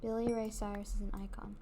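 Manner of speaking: breathy whispered aside. Real, not acted. Whispering to the camera about her idol. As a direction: whisper it like a secret.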